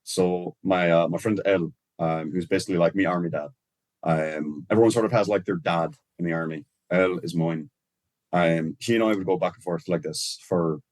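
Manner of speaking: relaxed Irish accent, casual. Real, not acted. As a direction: chat in a natural Irish accent. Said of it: "did your whole fucking accent just change".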